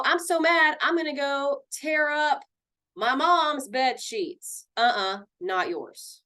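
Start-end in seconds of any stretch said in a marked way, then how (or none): 0.0-4.7 high-pitched, drawn-out tantrum; mock angry threat. Counselor mimics a kid about to wreck mom's things, mock angry threat. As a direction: storm off in hammed-up indignation. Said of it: "You don't go".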